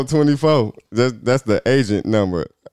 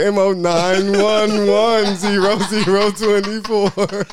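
slow code readout; 6 semitones higher, slower than usual. Pretend.

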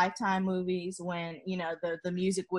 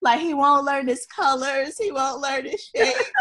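crying; 6 semitones higher, 4 dB louder than usual. Real, not acted. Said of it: "I'm literally hysterical".

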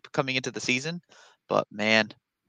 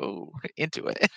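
quiet listener; 7 dB quieter than usual. Retold or imitated. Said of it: "quietly listening".

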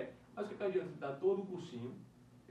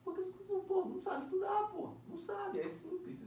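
much higher, incredulous mock scolding; exasperated answer; slower than usual. Scolding a student who asks why he failed, high and incredulous. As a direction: pitch it way up in disbelief.

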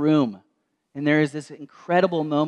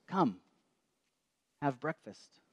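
softer, gentle invitation to breakfast; soft Jesus voice; 11 dB quieter, slower than usual. Acted. Jesus calling the disciples to breakfast, soft and gentle. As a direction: speak it softly like a quiet call from the shore.

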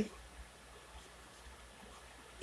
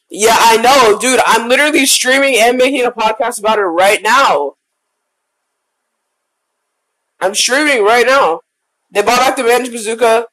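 loud; 8 dB louder than usual. Real, not acted. Loud on the phone to cousin.

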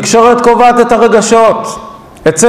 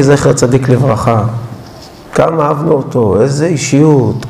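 lower, soft and unhurried; prayerful guided reflection; 9 semitones lower, 4 dB quieter than usual. Acted. Rabbi guiding the congregation to light candles, low and soft. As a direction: lead them gently toward the candles.

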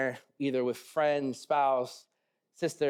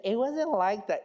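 higher-pitched imitation of a protesting wife; wife's objection; 4 semitones higher, slower than usual. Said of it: "she would say".

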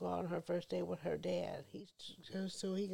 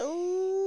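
panicked squeal; 13 semitones higher, 10 dB louder than usual. Retold or imitated. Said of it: "Yeah, I said".

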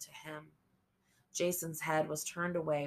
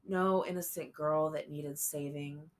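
usual speaking voice

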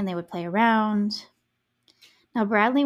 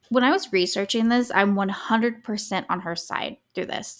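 ordinary talk

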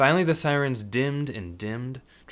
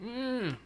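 high, soft gagged whimper; muffled whine; 7 dB quieter than usual. Acted. Hostage whining through the tape. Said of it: "whined through the tape".

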